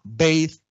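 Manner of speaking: BATHE with English 'ey' glide; English-style BATHE, vowel gliding to 'i'; English diphthong. Shown as a demonstration. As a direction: say BATHE as 'beyth'. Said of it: "cualquier diptongo A en inglés".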